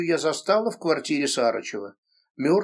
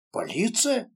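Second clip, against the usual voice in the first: feigned surprise; 6 semitones higher than usual. A narrator's reading. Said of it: "стараясь изобразить удивление".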